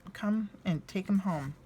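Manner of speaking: ordinary delivery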